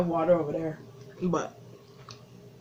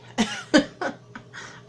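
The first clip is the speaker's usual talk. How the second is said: laugh; 8 semitones higher than usual. Real, not acted. Laughing at her own torture.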